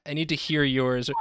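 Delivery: normal talking voice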